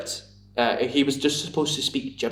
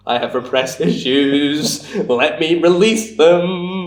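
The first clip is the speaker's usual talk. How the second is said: slow, stretched words growing louder; mock unhinged menace; 4 semitones higher, 6 dB louder, much slower than usual. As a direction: let it build like a hammed-up maniac. Acts out own wild-eyed pub look.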